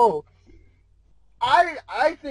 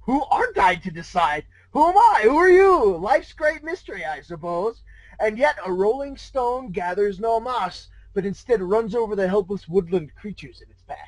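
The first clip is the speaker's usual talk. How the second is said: smug grandiose trickster. Acted. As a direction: play it like a smug cosmic riddler. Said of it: "He only seemed to get smug at this".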